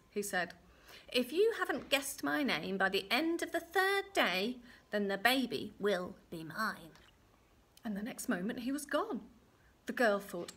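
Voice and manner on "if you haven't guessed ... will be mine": little man's threat. Acted. Little man threatens to take the baby, ominous. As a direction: build the condition, then land the threat.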